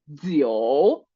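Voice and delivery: a low "Yo" dipping then rising. Shown as a demonstration. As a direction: draw the "Yo" down and up. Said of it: "que baja y luego sube".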